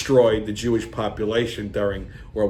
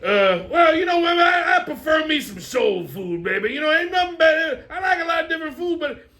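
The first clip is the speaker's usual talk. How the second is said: brash comic family banter; hammed-up sketch characters; 11 semitones higher than usual. Characters bicker over the host's questions in high put-on voices.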